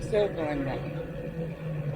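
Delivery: slow stretched-out words; drawn out. Real, not acted. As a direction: let each word drag out. Coasting downhill, drawn out.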